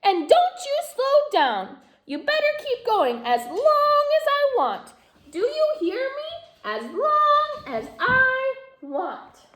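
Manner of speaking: a tyrant doll laying down the law; bossy doll. A narrator's reading. Doll bossing.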